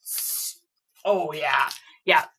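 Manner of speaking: ordinary talk, nothing marked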